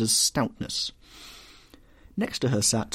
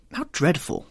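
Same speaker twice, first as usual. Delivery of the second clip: high, startled gasp of dismay; shocked exclamation; 8 semitones higher than usual. A narrator's reading. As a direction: sound scandalised. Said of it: "exclaimed Lady Agatha".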